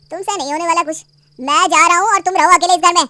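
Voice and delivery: loud, spiteful parting shot; angry walkout. Acted. Husband loudly storming out on his wife. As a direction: slam the words as you leave.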